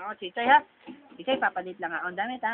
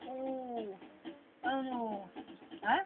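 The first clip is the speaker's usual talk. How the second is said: ordinary talk, nothing marked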